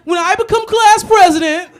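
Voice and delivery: very high, loud and slow schoolkid voice; pompous little kid on stage; exaggerated child speechmaker; kid candidate voice. Repeats the kid candidate line.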